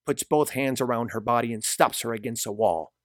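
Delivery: usual speaking voice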